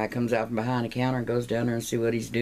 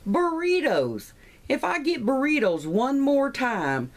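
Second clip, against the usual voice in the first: high, drawn-out mock outrage; hammed-up lunchtime disgust; exasperated complaint; 13 semitones higher, 4 dB louder, slower than usual. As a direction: stretch out the fed-up outburst, pitched way up. Acted fed-up lunch complaint.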